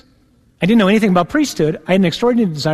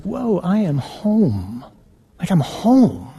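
hushed awe; 2 semitones higher, much slower than usual. Retold or imitated. Awe entering the seminary.

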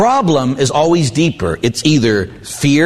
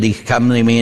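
normal talking voice

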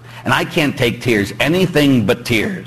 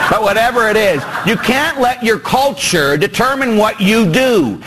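ordinary delivery

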